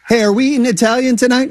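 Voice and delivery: high, quick question; asking kid. Acted. Kid asks stepdad about dinner, eager question.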